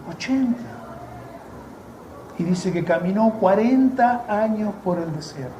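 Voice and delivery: ordinary delivery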